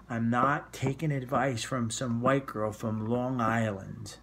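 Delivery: put-on slow, heavy Long Island accent as Estelle; an indignant mother in a broad Long Island accent; thick Long Island accent. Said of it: "in the great accent, the thicker version of the accent where I'm from".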